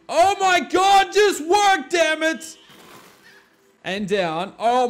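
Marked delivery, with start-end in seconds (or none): 0.1-2.6 high, loud exasperated yell at the machine; frustrated shout. Real, not acted. Gamer yelling at a broken contraption, frustrated shout. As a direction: shout at it to just work. Frustrated game build failing.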